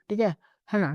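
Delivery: ordinary talk, nothing marked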